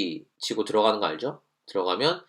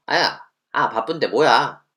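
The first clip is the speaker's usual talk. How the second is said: louder, higher irritated yelp; annoyed ouch; 6 semitones higher, 8 dB louder, slower than usual. Shown as a demonstration. Student snapping after being bumped while busy, annoyed. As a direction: snap at the one who bumped you.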